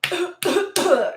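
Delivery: high mock coughing burst; imitated straining cough. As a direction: cough like a struggling turkey. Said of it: "trying to cough up".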